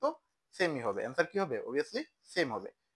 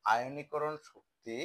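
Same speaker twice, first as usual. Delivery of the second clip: আয়নীকরণ Shakti said very slowly, word by word; slow dictation; much slower than usual. Shown as a demonstration. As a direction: spell it out slowly for the class. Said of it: "কয়টা part একসাথে করবো?". (ধীরে ধীরে বলা)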